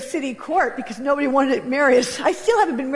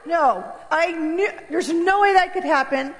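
usual speaking voice

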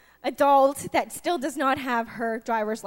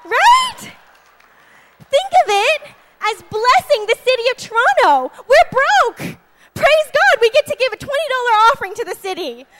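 much higher and louder, exultant; preaching shout; 8 semitones higher, 10 dB louder than usual. Real, not acted. Preacher shouting praise about paying the city, much louder. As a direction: shout it out with joy.

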